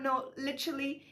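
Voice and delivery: normal talking voice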